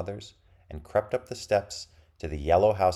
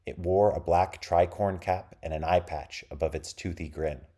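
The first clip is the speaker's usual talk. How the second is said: ordinary delivery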